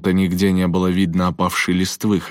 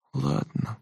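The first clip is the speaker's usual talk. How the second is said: soft, high, resigned sigh; 9 semitones higher, 8 dB quieter than usual. A narrator's reading. Bertie gives up the adventure, sighing. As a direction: give in softly with a sigh. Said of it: "вздохнул Берти".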